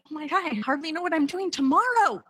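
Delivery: high-pitched frantic self-questioning; flustered panicking believer. Acted. Preacher voicing her own worried prayer, flustered and high. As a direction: spill it out in a rush of panicked questions. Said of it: "Sometimes I think".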